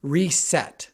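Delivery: loud, high call of cut; mock director's yell. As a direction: call it across the set. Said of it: "the director yells".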